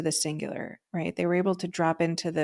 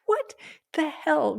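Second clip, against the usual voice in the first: a sharp high jump on hell; stunned disbelief; 9 semitones higher, slower than usual. Retold or imitated. Hearing his twin flame's dream, mock stunned disbelief. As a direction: react as if floored by the news. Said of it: "I was like".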